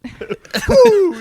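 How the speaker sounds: a loud, high laughing woo; excited whoop. Real, not acted. Cheering a friend's joke with a loud whoop. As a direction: whoop it out with delight.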